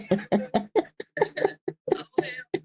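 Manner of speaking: long, high, breaking-up laughter; high-pitched laugh. Real, not acted. Cracking up at two pregnant women, high laughter.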